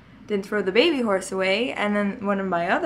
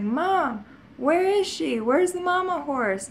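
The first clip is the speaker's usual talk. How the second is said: put-on upset child; 9 semitones higher than usual. Seven-year-old asking mom where her toy horse went, upset. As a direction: ask for the lost toy near tears.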